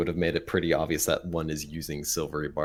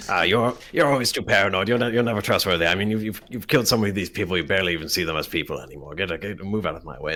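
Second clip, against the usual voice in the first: louder, rapid dismissive scolding; angry NPC; 4 semitones higher, 7 dB louder, faster than usual. Acted. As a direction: brush them off with contempt. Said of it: "there you go, perfect".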